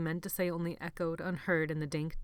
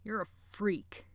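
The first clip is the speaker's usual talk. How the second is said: slow, disgusted contempt; 3 semitones higher, slower than usual. A narrator's reading. Sebastian condemning Jonathan, slow disgust. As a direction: spit out the contempt slowly. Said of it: "his voice dripping with disgust".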